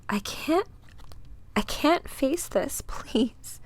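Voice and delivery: high, soft whispered begging; terrified whisper. Acted. Dinah begging the visions to stop, whispering. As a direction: plead in a breaking, high whisper. Victim breaking under mental attack.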